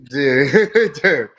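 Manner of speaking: loud laugh into dude; real laughter. Real, not acted. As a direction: burst out laughing mid-word. Cracking up at story.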